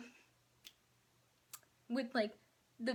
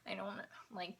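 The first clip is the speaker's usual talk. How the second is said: whispering; 9 dB quieter than usual. Retold or imitated. Muttering what she wished to say.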